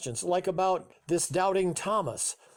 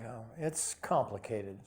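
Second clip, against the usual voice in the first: lower, softer, hesitant answer; 5 semitones lower, 8 dB quieter than usual. A narrator's reading. Father avoids explaining trouble with mother to his son, hesitant. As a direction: trail down quietly, reluctant to explain. Father dodges son's hard question.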